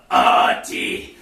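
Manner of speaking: low villain reveal; deep dramatic voice. Acted. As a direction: say the name very low. Twin brother reveal.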